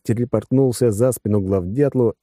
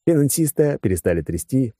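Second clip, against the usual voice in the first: plain reading voice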